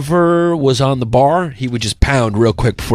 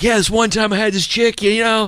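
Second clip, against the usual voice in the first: bragging bro voice; 9 semitones higher than usual. Retold or imitated. Mocking their own bragging stories.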